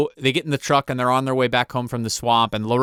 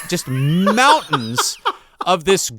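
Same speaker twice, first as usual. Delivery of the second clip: laughing; 4 semitones higher, slower than usual. Real, not acted. Laughing at absurd scene.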